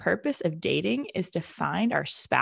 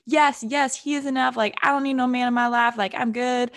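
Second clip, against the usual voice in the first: higher and louder, full of sass; sassiest voice; 6 semitones higher, 4 dB louder than usual. Pretend. Pretend comeback to the priest friend, sassy and high. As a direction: toss it off like nobody's needed, sassy. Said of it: "said in my sassiest voice ever".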